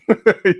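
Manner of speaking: a quick loud laugh. Real, not acted. Host laughing at a viewer's joke, loud.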